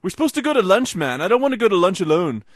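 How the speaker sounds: mock whiny plea, high and loud; pestering friend imitation. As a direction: needle them like a needy buddy. Retelling Aussie pestering him for lunch.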